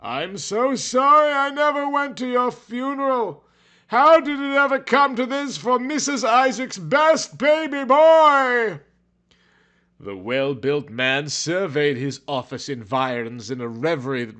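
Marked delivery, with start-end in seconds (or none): 0.0-8.8 high, hammed-up sobbing plea, much higher; sad cry to Mama. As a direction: wail for Mama like a melodramatic boy. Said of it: "he thought sadly".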